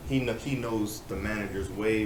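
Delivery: normal talking voice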